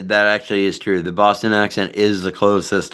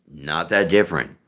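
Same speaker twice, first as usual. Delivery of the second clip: ordinary talk, nothing marked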